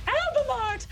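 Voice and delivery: very high squealed creature shout; high-pitched battle cry; creature's cry. Acted. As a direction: shriek it out like a small creature. Game master voices Baby's cry.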